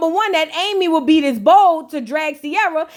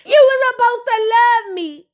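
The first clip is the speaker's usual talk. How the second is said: higher, hurt, hammed-up pleading; mock wounded whine; 7 semitones higher than usual. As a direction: whine like a betrayed friend. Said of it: "Sierra was like".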